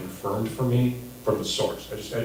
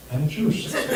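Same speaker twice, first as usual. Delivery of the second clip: higher, softer punchline; 7 semitones higher, 5 dB quieter, much faster than usual. Shown as a demonstration. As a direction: drop to a higher, hushed tag line. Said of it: "there's a difference between".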